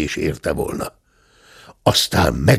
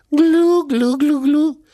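high gurgling glu glu gulps; gulping noise; 3 dB louder, slower than usual. Acted. The uncle gulping down the wine, loud high gurgles.

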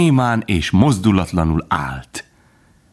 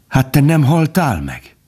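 high, moved disbelief; amazed; 9 semitones higher than usual. A narrator's reading. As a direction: ask it high, full of astonishment. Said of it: "mód felett csodálkozott".

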